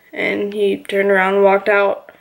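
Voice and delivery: ordinary talk, nothing marked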